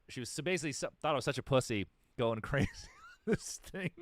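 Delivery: ordinary talk, nothing marked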